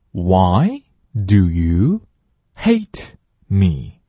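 very slow, stress on why; 慢速领读; slow model read. Shown as a demonstration. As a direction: spell it out slowly. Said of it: "第二句".

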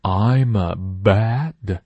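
"bad" with the b fully voiced, slow; slow "bad", voiced b not p. Shown as a demonstration. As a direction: buzz the vocal cords on the b of "bad". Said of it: "声带震动".

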